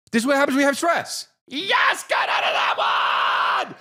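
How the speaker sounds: high, fast mock shout of stress. Comic showing what stress does to him, high hammed-up yell.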